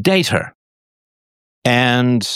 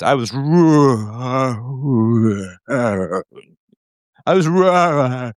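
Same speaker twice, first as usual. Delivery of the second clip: the Fonz; 3 semitones higher, much slower than usual. Retold or imitated. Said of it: "Like the Fonz in the old Happy Days".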